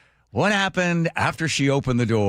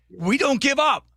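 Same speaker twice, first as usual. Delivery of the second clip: preaching friend; 11 semitones higher than usual. Retold or imitated. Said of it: "And you said".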